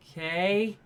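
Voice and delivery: high, rising, questioning "okay"; hesitant okay. Retold or imitated. Actor told he's being sent to Angel, doubtful rising agreement. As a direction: accept it uncertainly, voice climbing. Acts actor's wary reply to news.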